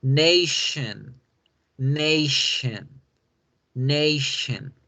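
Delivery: "nation" with sh in the middle, nay-shun; "nation": tion said as shan; English nation. Shown as a demonstration. Teacher drills the word for students, clear English. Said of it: "ese tion se debe pronunciar shan".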